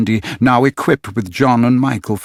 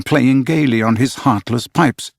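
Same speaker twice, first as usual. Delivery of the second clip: usual speaking voice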